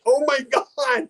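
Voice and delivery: high, slow, drawn-out gasp of disbelief; imitating the wife's stunned reaction; mock shocked wife. Wife reacting to Chewy's sympathy flowers, high mock shock. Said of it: "And my wife's like".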